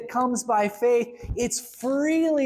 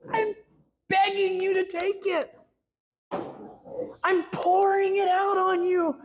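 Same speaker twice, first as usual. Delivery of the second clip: higher, urgent plea to take it; pleading God; 7 semitones higher than usual. Retold or imitated. God begging a hesitant believer, higher and pleading. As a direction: beg them to take the gift. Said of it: "And he's like".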